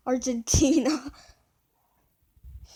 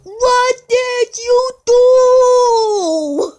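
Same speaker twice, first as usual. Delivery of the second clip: high, loud, drawn-out wail; dismayed wail; 10 semitones higher, 12 dB louder, much slower than usual. Real, not acted. Reacting in dismay to what was done, loud wail. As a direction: cry it out slowly, stretching the last word.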